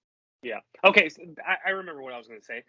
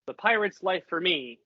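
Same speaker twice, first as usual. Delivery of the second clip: higher, louder pirate battle cry; rally the crew with sword raised; rousing vow; 5 semitones higher, 5 dB louder than usual. Acted. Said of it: "I pull my sword out".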